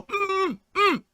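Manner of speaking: high-pitched closed-mouth "mm"; sewn-shut mumbling hum. Acted. Person with mouth sewn shut trying to talk, high muffled hums. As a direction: squeal two hums through sealed lips. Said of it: "'cause you might go".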